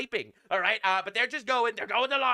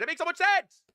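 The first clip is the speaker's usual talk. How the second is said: higher, louder sarcastic exclamation; sarcastic shout; 9 semitones higher, 4 dB louder than usual. Real, not acted. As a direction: shout it in mock agreement. Sarcastic outburst.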